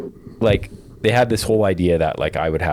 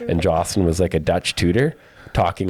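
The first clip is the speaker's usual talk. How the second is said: usual speaking voice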